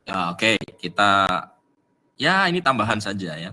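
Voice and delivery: normal talking voice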